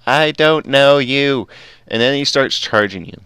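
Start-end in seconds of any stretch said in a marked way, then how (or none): 0.1-1.5 loud, slowed-down freaked-out repeat; panicked Enderman, drawn out. Acted. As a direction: stretch the plea out slowly and loudly. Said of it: "That's when he goes".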